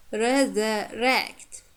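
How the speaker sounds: very slow "resurrect", weak "sur", strong "rect"; deliberate teacher's "resurrect", stressed at the end; stress on last syllable. Shown as a demonstration. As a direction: stretch out "resurrect" and land on "rect". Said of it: "last syllable is stressed".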